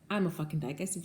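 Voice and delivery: ordinary delivery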